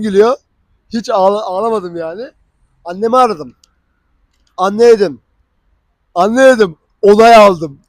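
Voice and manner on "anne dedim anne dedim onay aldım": loud joyful call to mom; calling mom to announce the visa, loud and excited. Retold or imitated. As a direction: shout the good news down the phone.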